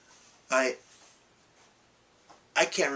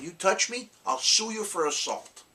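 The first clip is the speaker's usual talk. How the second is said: defiant threat; 9 semitones higher, 3 dB louder than usual. Retold or imitated. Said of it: "I said".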